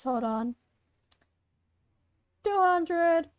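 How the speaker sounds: usual speaking voice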